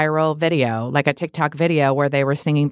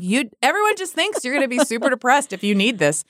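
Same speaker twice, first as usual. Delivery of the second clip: higher, mocking the expectation; husband's joking voice; 7 semitones higher than usual. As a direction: lift it, mocking the gloom. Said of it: "he's like".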